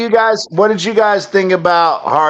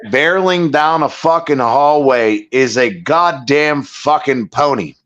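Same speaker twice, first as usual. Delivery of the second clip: normal talking voice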